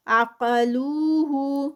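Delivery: slow drawn-out tajweed recitation, vowels held; Quran recitation. Shown as a demonstration. Tajweed teacher reciting a verse for students, slow melodic recitation. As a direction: chant each word with its lengthening. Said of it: "لو پہ ٹو counts".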